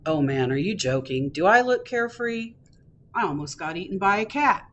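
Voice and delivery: ordinary delivery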